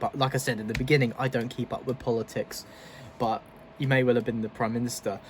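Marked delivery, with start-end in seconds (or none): none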